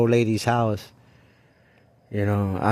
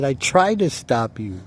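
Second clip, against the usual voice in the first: raised and higher, almost shouting; insisting loudly; 6 semitones higher, 4 dB louder than usual. Retold or imitated. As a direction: throw it back at him in anger. Arguing with the kid who stole.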